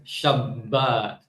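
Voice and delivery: "Shabbat" with held b and long final ah; Mizrahi pronunciation. Shown as a demonstration. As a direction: say "Shabbat" as shab-BAAT, hard t at the end. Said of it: "Again with the doubling".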